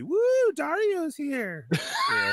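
high, slow hype call; excited whoop. Real, not acted. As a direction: hype up the arrival. Hyping a guest's arrival.

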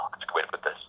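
soft unvoiced mutter to himself; whispered aside. Real, not acted. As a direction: drop to a whisper, thinking aloud. Muttered aside while searching words.

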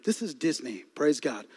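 low, soft ironic aside; deadpan. Shown as a demonstration. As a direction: say it dry under your breath. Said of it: "Y'all, this is Disney".

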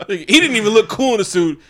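chuckling through the line; laughing. Real, not acted. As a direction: laugh as you say it. Laughing while correcting himself.

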